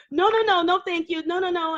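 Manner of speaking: high, prim no-thank-you; polite refusal. Retold or imitated. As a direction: decline him sweetly but firmly. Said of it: "I'll go".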